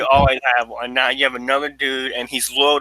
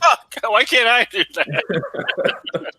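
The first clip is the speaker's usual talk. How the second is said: whined slowly and high, laughing; a hammed-up frustrated whine; mock whining; 7 semitones higher, much slower than usual. Guy whining that he can't carve pumpkins, mock frustration. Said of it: "And he's like".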